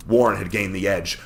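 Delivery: usual speaking voice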